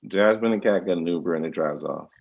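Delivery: ordinary talk, nothing marked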